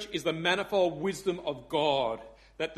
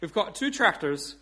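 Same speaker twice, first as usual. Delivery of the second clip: put-on Irish accent; 3 dB louder than usual. Pub regular updating the dictator, punchy Irish accent. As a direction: announce it proudly in an Irish accent. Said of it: "I do do an Irish accent".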